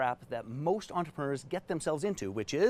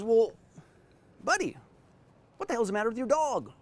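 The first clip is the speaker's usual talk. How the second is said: high-pitched mock old gentleman; 6 semitones higher than usual. Elderly visitor asking his friend about the dog, high put-on voice. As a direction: play the puzzled visitor, voice pushed up high. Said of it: "And the visitor says".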